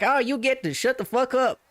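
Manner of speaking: high-pitched mock comeback; imitated retort. Streamer snapping back at a chat question, mock retort. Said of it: "then he was like".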